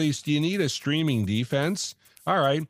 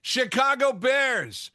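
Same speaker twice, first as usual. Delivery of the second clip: usual speaking voice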